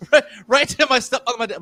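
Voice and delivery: talking through laughter; laughing. Real, not acted. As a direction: laugh it out while telling. Laughing while telling.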